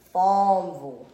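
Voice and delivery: drawled Southern Farmville, r softened away. Shown as a demonstration. Local showing how old townsfolk say it, Southern drawl. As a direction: lean on a Southern "Fahmvul". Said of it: "Gentry has that drawl".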